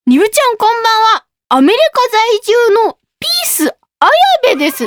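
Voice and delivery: high, lively comedian's greeting; Ayabe. Acted. Host reading a comedian's message from America, high and showy.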